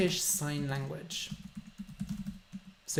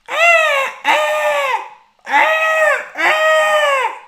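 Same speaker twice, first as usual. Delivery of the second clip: very high, loud shouted caws; crow call; 14 dB louder, much slower than usual. Shown as a demonstration. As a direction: caw like a crow calling out. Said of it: "And they go".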